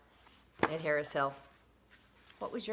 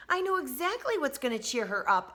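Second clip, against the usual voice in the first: put-on excited child; 7 semitones higher than usual. A narrator's reading. Child voiced in read-aloud.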